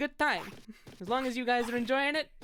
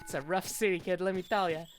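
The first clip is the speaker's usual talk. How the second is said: gruff New York wise guy advice; a tough New York voice, let-me-tell-ya style; New York accent. Acted. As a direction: lean in like an old New Yorker. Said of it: "how I'll get in New York is".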